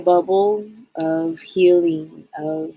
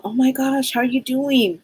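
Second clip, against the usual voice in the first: higher, delighted greeting; smiling phone voice; 5 semitones higher than usual. Acted. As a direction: greet a caller with an audible smile. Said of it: "you can tell I'm smiling".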